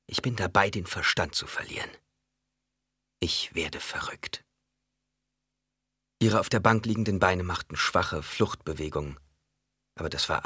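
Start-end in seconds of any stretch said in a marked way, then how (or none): 0.1-4.4 inner thought. A narrator's reading. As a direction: think it aloud, losing voice towards the end. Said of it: "der einzige eigenständige Gedanke". (innerer Gedanke, ins Flüstern abfallend)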